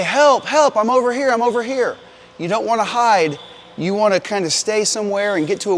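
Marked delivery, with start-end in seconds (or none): 0.0-2.0 high, loud cry for help; yelling for help. Shown as a demonstration. Firefighter showing kids how to yell back from a burning house, high loud call. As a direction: yell back so the rescuer can find you. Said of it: "you need to say".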